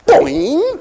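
spring up like a rubber ball; cartoon boing.

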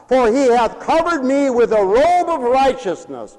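high, loud scripture proclamation; full-force preaching. Real, not acted. Preacher singing with the prophet of joy, full-force preaching. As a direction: declaim the verse in long, ringing phrases.

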